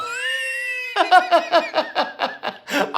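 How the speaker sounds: very high, loud burst of laughter; shrieking laugh. Real, not acted. Laughing at own fright.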